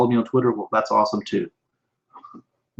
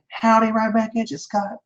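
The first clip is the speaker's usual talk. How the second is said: a lightly sung-up, much higher howdy; playful high howdy; 10 semitones higher than usual. Acted. Greeting viewer Scott back on the stream, playful high voice.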